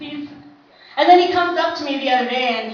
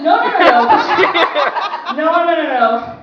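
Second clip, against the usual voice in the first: loud, laughing no no no; shouted protest; 5 semitones higher, 7 dB louder than usual. Retold or imitated. Sister shouting at her brother to stop, laughing. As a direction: stop him in a panic, laughing.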